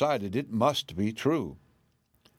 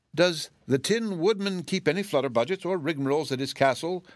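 uneasily; 6 semitones higher than usual. A narrator's reading. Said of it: "inquired Aunt Em uneasily".

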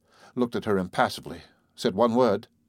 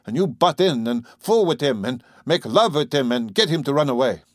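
higher, heated farmer's rant; ranting old farmer; 6 semitones higher than usual. A narrator's reading. Father accusing her of luring his son, heated. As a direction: keep hammering the accusation.

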